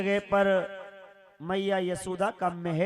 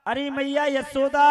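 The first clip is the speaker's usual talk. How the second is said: calling out to the house, raised voice; 5 semitones higher, 5 dB louder than usual. Acted. Uddhav calls for Yashoda, calling out. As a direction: call her name across the palace. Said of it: "आवाज लगाई".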